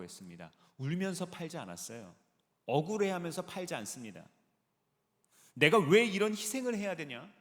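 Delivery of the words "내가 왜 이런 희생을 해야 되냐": loud, higher-pitched imitated grumble; mock complaining. Pastor voicing the complaint the man never made, mock indignant. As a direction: protest like someone resenting a sacrifice. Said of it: "이렇게 불평하지도 않았대요".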